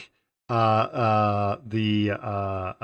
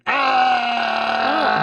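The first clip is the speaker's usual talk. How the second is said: mock scream; 12 semitones higher, 4 dB louder than usual. Characters yelling in film.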